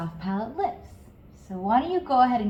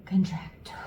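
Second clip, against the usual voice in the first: whispered cue; 2 semitones lower, 3 dB quieter than usual. Shown as a demonstration.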